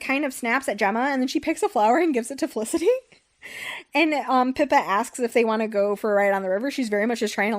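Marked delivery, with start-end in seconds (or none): none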